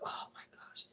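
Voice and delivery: unvoiced awed whisper; hushed mock awe. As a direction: breathe it in awe. Imagined reaction to full plate.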